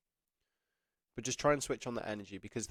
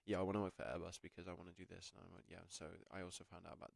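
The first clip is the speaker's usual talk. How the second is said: imitating a flat mumble, lower and much softer; energy off; 5 semitones lower, 12 dB quieter than usual. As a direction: let it drain out of you, barely there. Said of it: "his energy's off".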